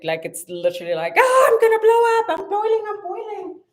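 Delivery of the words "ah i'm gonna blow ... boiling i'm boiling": high, loud melodramatic teapot voice; dramatic teapot. Pretend. Voicing her teapot as a drama queen, hammed up. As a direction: ham up a kettle about to burst. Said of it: "it is so dramatic".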